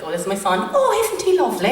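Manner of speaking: high, gushing proud mother voice; mother showing off her son. Retold or imitated. Mother shows off her son to a woman, high gushing coo. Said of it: "she was like".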